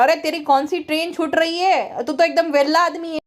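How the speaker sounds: usual speaking voice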